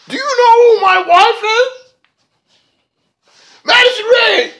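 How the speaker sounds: very high, loud, drawn-out screech; hammed-up screaming rant, words stretched out; mock outraged yelling. Reviewer imitates Josh interrupting in the ring, mock screaming.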